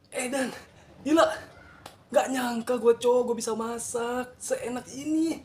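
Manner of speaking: amazed, high and slow; excited. Real, not acted. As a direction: marvel at your own cooking. Amazed at his own cooking.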